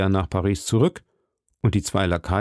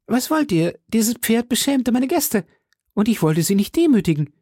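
high, put-on character voice; hearty musketeer host; 12 semitones higher than usual. Host explains away his missing horse, high voice. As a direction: justify yourself in a high, lively voice. Porthos voiced in story dialogue.

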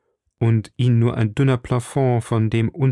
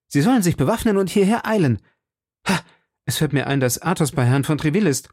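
much higher, fast, urgent commands; urgent orders; 6 semitones higher than usual. A narrator's reading. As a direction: rattle off the orders in haste. D'Artagnan gives hurried orders.

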